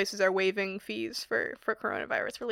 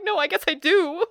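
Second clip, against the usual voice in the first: higher, reluctant give-in; mock resigned; 7 semitones higher, 5 dB louder than usual. She gives in to the barista, mock resigned. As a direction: concede with a shrug in the voice. Giving in.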